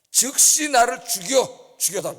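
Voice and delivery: very loud, high, slow cry to be killed; desperate cry. Acted. Preacher voicing Moses begging God to end his life. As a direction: wail it out in despair. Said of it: "그 다음 기도를 좀 보세요".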